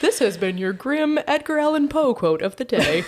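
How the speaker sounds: upbeat radio-host sign-off; announcer voice. Pretend. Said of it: "[name]'s announcer voice".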